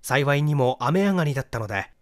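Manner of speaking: ordinary delivery, nothing marked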